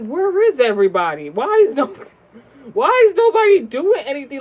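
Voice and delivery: high, loud exasperated outcry; retold alarm at full volume; loud disbelief. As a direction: call out for help that isn't coming. Acting out panic at no help.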